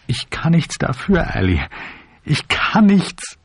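high, slow, tearful plea; helpless pleading. A narrator's reading. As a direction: wail helplessly, drawing out the words. Weeping man pleads helplessly.

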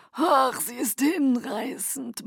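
murmured delight. Acted. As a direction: savour the word, charmed. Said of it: "murmelte er".

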